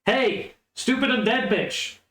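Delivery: high, drawn-out call across the way; mock yell. Player's character to the vampire lady, mock yell. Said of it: "yells over at the vampire lady".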